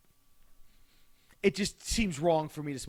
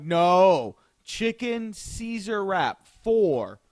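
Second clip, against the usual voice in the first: louder, very slow, each word spelled out; slow insistent order; 3 semitones higher, 7 dB louder, much slower than usual. Retold or imitated. Correcting the clerk's order, loud and very slow. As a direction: correct her slowly and firmly. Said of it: "I'm like".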